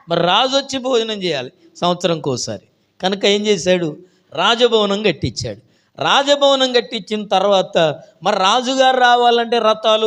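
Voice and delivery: high, loud, stretched list; fervent preaching. Acted. Preacher lists chariots and horses, loud and building. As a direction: pile up the royal procession.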